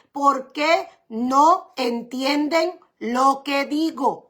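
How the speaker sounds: very slow, drawing out each word; slow weighed question. Shown as a demonstration. Preacher to congregation quoting Jesus, very slow. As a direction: ask it slowly, letting it sink in.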